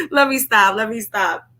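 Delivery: ordinary delivery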